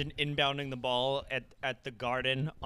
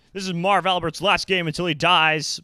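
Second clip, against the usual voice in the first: loud mock announcer; 4 semitones higher, 11 dB louder than usual.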